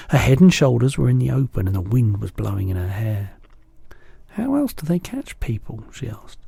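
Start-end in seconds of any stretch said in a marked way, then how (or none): 4.3-5.8 put-on much higher little girl voice; a small girl asking anxiously; high childlike voice; Sophie. A narrator's reading.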